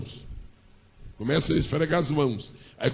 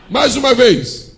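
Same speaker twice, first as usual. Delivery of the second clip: loud call; 7 semitones higher, 9 dB louder, slower than usual. Real, not acted. Urging the congregation to repeat.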